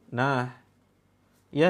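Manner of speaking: usual speaking voice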